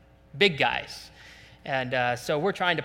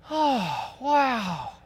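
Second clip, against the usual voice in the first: mock dreamy; 5 semitones higher, slower than usual. Preacher mimics a hearer who forgot the Word, mock dreamy. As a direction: swoon as if you forgot everything.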